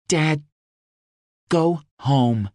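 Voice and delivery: a lightly voiced daughter, anxious; Rose. A narrator's reading. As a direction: Rose telling her father the situation is grim.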